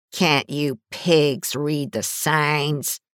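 slow, drawn-out taunt; cocky sneer. A narrator's reading. Gang leader taunts the officer with a cocky grin. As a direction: sneer it at the cops. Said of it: "He shot her a cocky grin".

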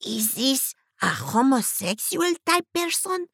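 pry into her ear; nosy old auntie. A narrator's reading. Auntie Redmilla asks Sophie about the hippie.

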